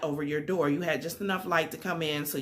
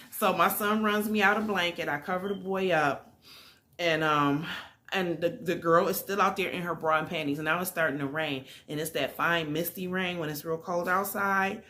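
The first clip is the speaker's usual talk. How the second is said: ordinary delivery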